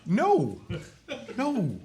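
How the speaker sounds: high, loud playful protest; indignant no. Real, not acted. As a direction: protest with a laugh. Denying he made it up.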